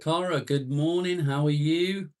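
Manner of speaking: high, singsong morning hello; calling out greeting. Real, not acted. Hailing a viewer joining the stream.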